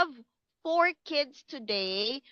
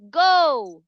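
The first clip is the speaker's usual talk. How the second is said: loud go; 3 semitones higher, 8 dB louder than usual. Shown as a demonstration. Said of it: "countdown for the kids".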